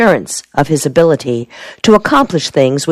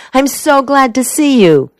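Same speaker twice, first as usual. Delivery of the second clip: higher-pitched happy welcome, SO lifted; glad exclamation; 9 semitones higher than usual. A narrator's reading. Helen welcomes her soldier at the door, glad exclamation. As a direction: welcome a sweetheart with delight. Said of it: "she exclaimed".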